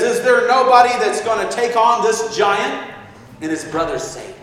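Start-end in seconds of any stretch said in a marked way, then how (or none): 0.0-2.9 higher and louder, chest out; a small boy shouting for a champion; bold boyish challenge. Acted. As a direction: call out to the whole camp as the boy David. Boy David challenges the soldiers.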